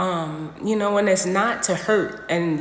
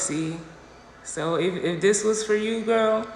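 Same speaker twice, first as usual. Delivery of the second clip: ordinary talk, nothing marked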